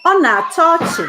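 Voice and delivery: high, loud laughing exclamation; amused. Shown as a demonstration. Teacher showing students how to say it's funny, high and loud. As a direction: burst out as if it's hilarious. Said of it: "It is funny".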